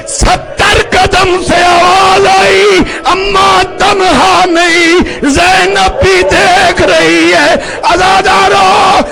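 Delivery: sung, wailing sermon cry at full voice; full-force preaching. Acted. Zakir wails Hussain's martyrdom to the mourners, high sung preaching. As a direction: chant the lament high and strong, voice near tears.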